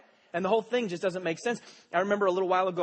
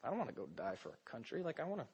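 much lower, much softer private thought; inner thought; 7 semitones lower, 15 dB quieter than usual. Retold or imitated. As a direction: confess it half under your breath. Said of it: "I'm thinking to myself".

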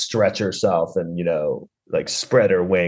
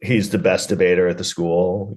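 normal talking voice

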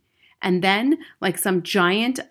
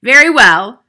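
loud, booming headmistress; big, higher-pitched commanding boom; 5 semitones higher, 17 dB louder than usual. A narrator's reading. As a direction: boom it out over the class. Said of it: "boomed the Trunchbull".

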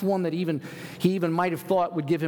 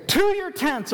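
rallying cry; 13 semitones higher, 4 dB louder than usual. Acted. Tribes proclaim civil war.

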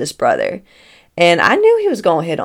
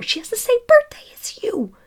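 much higher, hushed excited whisper. Retold or imitated. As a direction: whisper the news eagerly. Man telling daughter on phone.

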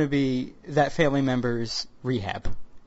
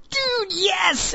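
retold excited cheer; 14 semitones higher than usual.

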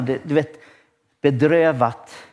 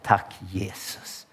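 soft grateful thanks; 7 semitones lower, 10 dB quieter, much slower than usual. Retold or imitated. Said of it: "Då säger man bara det".

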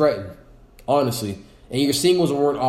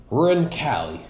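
ordinary talk, nothing marked

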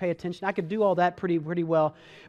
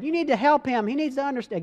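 imitating mom; 6 semitones higher than usual. Mom telling son to get involved, higher mock-mom voice. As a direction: put on a prim mother's voice. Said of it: "I don't know why I'm talking that way".

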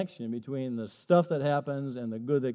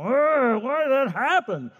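higher, louder exasperated whine; mock complaint; 9 semitones higher, 4 dB louder, slower than usual. A believer complaining to God, higher and louder. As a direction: throw the question up at God.

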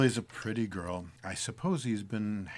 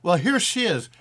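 hearty greeting; 10 semitones higher, 7 dB louder than usual. Acted. Greets niece loudly.

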